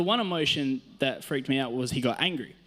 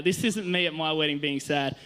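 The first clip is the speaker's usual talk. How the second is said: ordinary talk, nothing marked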